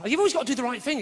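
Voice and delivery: ordinary talk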